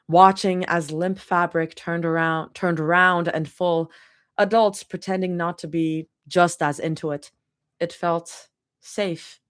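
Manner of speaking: plain reading voice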